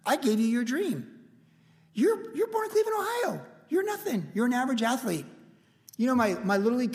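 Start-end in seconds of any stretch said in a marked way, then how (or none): 0.0-5.2 high, distant voice of God; lightly voiced God recounting a gift; voice of the Lord. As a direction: speak down from heaven, gently. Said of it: "But when he said".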